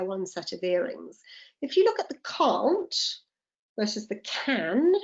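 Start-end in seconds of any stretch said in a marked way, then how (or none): 2.2-3.0 CAN'T with a long open "ah" vowel; drawn-out "ah" in can't, British style. Shown as a demonstration. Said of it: "pronunciation of my vowel changes". 4.2-4.9 CAN with a short front "a" vowel; British can. Shown as a demonstration. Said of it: "pronunciation of my vowel changes".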